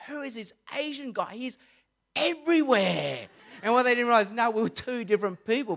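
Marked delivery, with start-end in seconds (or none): 0.1-3.3 rugby opponents confused about the player; puzzled rival players. Retold or imitated.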